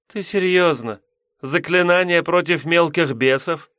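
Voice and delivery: high, scornful demoness voice; contemptuous. A narrator's reading. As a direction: sneer at the feeble spell. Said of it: "презрительно скривилась суккуба".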